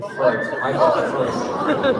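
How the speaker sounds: embarrassed laugh. Real, not acted. Laughs at own mistake.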